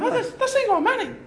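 high, excited gasping fan; starstruck fan. Retold or imitated. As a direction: gush like you've spotted a star. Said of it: "you're going".

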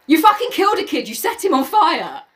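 outraged reader yelling at Ralph; mock outrage. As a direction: blame him for the dead kid. Accusing Ralph in the scene.

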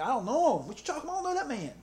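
high, indignant I don't know him; angry denial. Retold or imitated. Preacher voicing Peter's indignant denial. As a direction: play Peter hotly denying he knows the man. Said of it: "he's being very, very strong".

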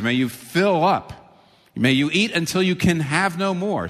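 a grand host urging guests to eat their fill; lavish host. Acted. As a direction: spread the invitation wide and generous. Potentate's blessing voiced.

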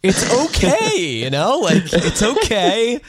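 high-pitched, drawn-out okays; emphatic reassurance. Acted. Host reassuring people who deny they slept, emphatic reassurance. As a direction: insist slowly and high that it is fine.

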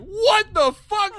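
high, loud, slow shocked cry; a boy fainting two seats away, shocked disbelief; shocked what the fuck. Retold or imitated. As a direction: blurt it out in disbelief. Classmate fainted beside him.

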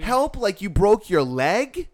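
loud, high, slow disbelief; exasperated scolding. Retold or imitated. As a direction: hammer the question out slowly. Acts out scolding the man.

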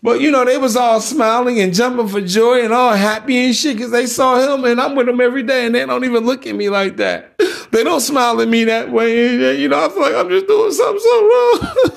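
high mock sobbing that climbs into a wail; crocodile tears. Girlfriend hurt that her kids smile at their dad, fake crying. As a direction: imitate a woman crying fake tears, pitch soaring at the end. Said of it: "watch those fake ass crocodile tears".

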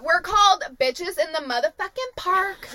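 usual speaking voice

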